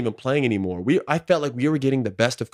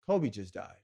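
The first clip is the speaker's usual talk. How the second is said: much softer, low telling of a death; hushed bad news; 4 semitones lower, 9 dB quieter, slower than usual. Teammate grabbing him in the locker room, softly and gravely. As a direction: say it quietly, like someone breaking terrible news. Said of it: "and he goes".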